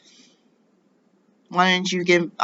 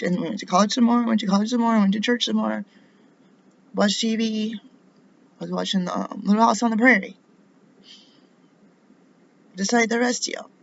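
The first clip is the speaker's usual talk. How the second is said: raised pitch, insistent listing; pointed sarcasm; 5 semitones higher than usual. Real, not acted. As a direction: pile up the items sarcastically, voice held high. Sarcastic list of an ordinary upbringing.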